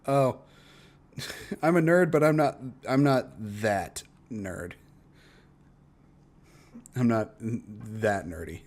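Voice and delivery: usual speaking voice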